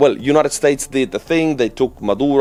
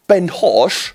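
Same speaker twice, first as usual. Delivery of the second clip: Ben Hosh in a mock Cockney accent, pitched higher; 7 semitones higher than usual. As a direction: do a cheeky Cockney take on the name, with Husk said as Hosh. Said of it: "the Cockney Arthur".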